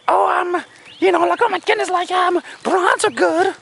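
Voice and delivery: put-on a nervous girl scrambling to defend herself; gushing girl. Wrong way to react to jab.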